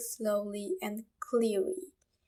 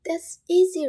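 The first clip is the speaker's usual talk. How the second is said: excited; 7 semitones higher, 10 dB louder than usual. Real, not acted. Teacher cheering the class on.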